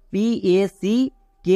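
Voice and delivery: very slow spelling of পিএসিকেইটি, letter by letter. Shown as a demonstration. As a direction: spell out P-A-C-K-E-T slowly. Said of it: "packet".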